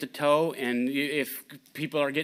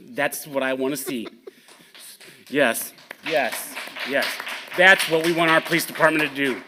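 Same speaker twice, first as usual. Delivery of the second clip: laughing, then much louder cheering yes; 9 dB louder than usual. Real, not acted. Councilman laughing, then loudly cheering the towed truck. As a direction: laugh and cheer the result, building up.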